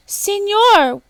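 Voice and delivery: very high, loud squawk; a bird's shrill cry; squawking toucan. A narrator's reading. Toucan squawking at the woodcutter, very high and loud. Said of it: "squawked the toucan".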